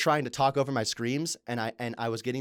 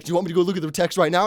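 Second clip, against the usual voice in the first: fast, loud, challenging question; 6 semitones higher, 9 dB louder, faster than usual. Retold or imitated. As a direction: dare him to check the text. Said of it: "you're like".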